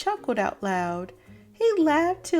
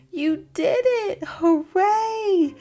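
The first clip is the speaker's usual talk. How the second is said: joyful cheer; 5 semitones higher, 5 dB louder, slower than usual. Acted. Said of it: "hugged her and kissed her and started to cry".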